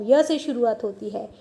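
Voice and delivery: normal talking voice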